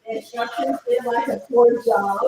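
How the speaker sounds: ordinary delivery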